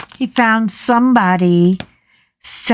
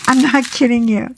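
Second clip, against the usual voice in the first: normal talking voice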